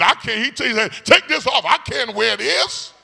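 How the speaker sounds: put-on high, loud cry of the boy David; David protesting. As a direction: play David pulling off the armor.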